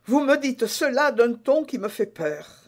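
tearful words. Acted. Constance to D'Artagnan, frightened and tearful. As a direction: speak through a held-back tear. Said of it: "prononça ces paroles avec une larme".

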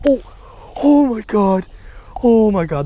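loud, high-pitched burst of surprise; real excited shock. Real, not acted. Angler sees a crappie flash by, real excited shock. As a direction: cry out in sudden astonishment.

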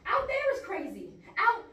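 loud, high insistence on danger outside; agitated outburst. Acted. Refuses to leave the room.

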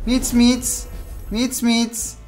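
high coaxing kitty call; calling a cat. Acted. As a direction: coax the cat sweetly.

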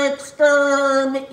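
slow, loud robotic Dalek. Acted. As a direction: drag out each syllable like a Dalek. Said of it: "fancy doing a Dalek today".

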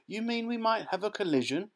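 high, anxious question; alarmed. A narrator's reading. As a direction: ask it with rising dread. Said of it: "Mr Teavee alarmed".

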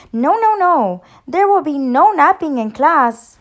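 high, loud, emphatic declaration; firm declaration. A narrator's reading. Bird teacher laying down class rules to a dog, firmly declaring. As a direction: lay down the rule loudly and clearly. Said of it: "declared the bird".

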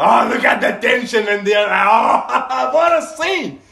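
loud, high-pitched amazement breaking into laughter; thrilled exclamation. Real, not acted. Amazed reaction to the scene.

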